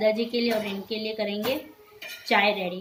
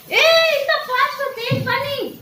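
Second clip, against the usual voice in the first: scolding; 13 semitones higher than usual. Real, not acted. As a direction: tell her off for wasting water. Scolding child wasting water. (ऊँची आवाज़ में डाँटना)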